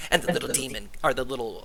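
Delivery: ordinary delivery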